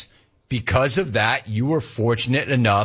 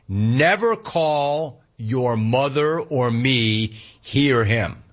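slow, drawn-out emphatic rule; much slower than usual. Retold or imitated. Dad laying down a rule to his child, emphatic. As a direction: lay down the rule slowly and emphatically. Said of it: "like, emphatic".

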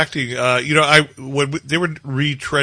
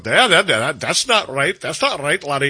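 Scottish complaint. Retold or imitated. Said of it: "all the Scots are going".

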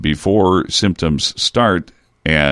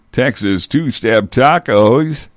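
hard sell ad read, slow and higher; commercial voice; 6 semitones higher, slower than usual. Shown as a demonstration. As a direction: announce it like a TV food ad. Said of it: "I'm using my commercial voice".

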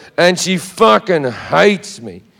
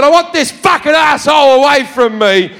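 angry shout at the seatmate; a furious passenger demanding a new seat; retold shout; 5 semitones higher than usual. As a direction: yell it, raising pitch. Shouting at stewardess on plane.

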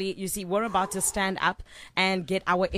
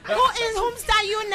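put-on very high Nigerian-accented shout; outraged mock Nigerian voice; 13 semitones higher than usual. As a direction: cry out in a hammed-up Nigerian accent. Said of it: "do it in a Nigerian accent".

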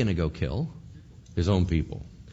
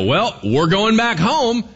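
loud, slow defiant reply; loud, slow defiant nation; defiant crowd; 10 semitones higher, 7 dB louder, slower than usual. Acted. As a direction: say it like a crowd walking out. Nation walks away.

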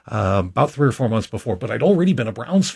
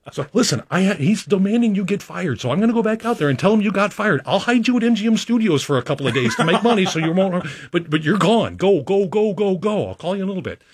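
a light, higher, lightly voiced supervisor, laughing then hurried; voicing the supervisor; 8 semitones higher than usual. Supervisor tells guide he's fired, laughing and urgent. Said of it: "And he goes".

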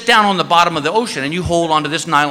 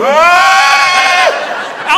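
loud, high comic shriek of terror; high-pitched scream; 11 semitones higher, 5 dB louder than usual. Retold or imitated. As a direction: scream as if the shark is coming. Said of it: "I heard this high-pitched screaming".

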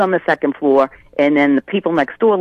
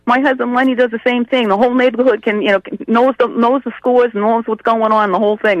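higher-pitched retold reassurance; imitated neighbour wife; 6 semitones higher than usual. Sandy telling the mother her husband does the same, imitated exclaiming. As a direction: play the neighbour wife, voice held up high. Acts out neighbour wife's reply.

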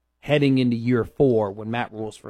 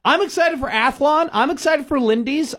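mock excitement; 12 semitones higher than usual. Mock-giddy about preseason magazines.

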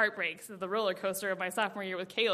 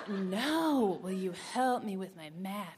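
"help" leaned on, soft and laughing; stress on help; 3 semitones lower, 3 dB quieter, slower than usual. Retold or imitated. Boy insisting on his line, stress on "help". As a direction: press on "help" again, a bit hushed.

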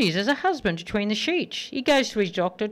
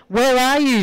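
louder, higher call; 5 semitones higher, 7 dB louder than usual. Acted. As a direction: yell it into the dark. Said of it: "said the husband".

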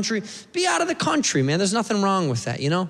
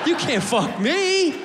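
higher, slow shouted defiance; defiant shout; 8 semitones higher, slower than usual. Retold or imitated. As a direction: yell it like you outsmarted them. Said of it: "I go".

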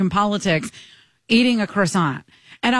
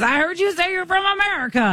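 high-pitched mock shout; imitated shouting; 9 semitones higher than usual. Retelling a grandpa-aged Canadian accosting her on the beach, mock shouting. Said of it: "that thing where he's shouting".

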